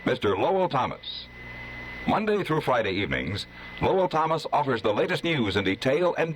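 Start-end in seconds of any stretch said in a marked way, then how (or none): none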